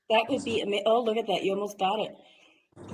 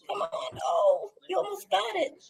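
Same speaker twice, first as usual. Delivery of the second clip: breathless urging; 8 semitones higher than usual. Real, not acted. Urging the spirit to push.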